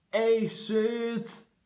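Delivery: high, bright exclamation; excited reveal. Real, not acted. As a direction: announce the name with glee.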